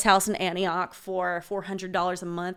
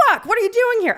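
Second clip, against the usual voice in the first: high, loud alarmed outburst; startled yell; 13 semitones higher, 6 dB louder, faster than usual. Acted. Woman startled by roommate bursting into her room, startled yell. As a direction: shriek at an intruder.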